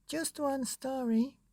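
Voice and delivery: put-on high, soft little baby voice; tiny child asking; high soft baby begging.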